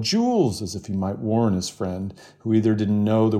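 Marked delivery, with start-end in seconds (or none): 0.0-0.6 high call of warning; yelled name. A narrator's reading. Rye warning his friend Jules, calling out. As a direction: shout the name to warn a friend. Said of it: "and Rye yelled".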